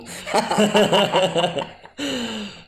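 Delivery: high, loud burst of laughter; big laugh. Real, not acted. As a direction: crack up helplessly at a silly joke. Laughs at sparkler sword joke.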